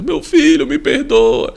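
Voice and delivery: a hint of a sobbing father, pitch jumps high; crying father. Father begs his son for forgiveness on the phone, mock tearful. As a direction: imitate his father breaking down, mildly. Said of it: "meu pai começou a chorar muito, desesperado".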